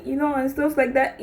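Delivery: ordinary talk, nothing marked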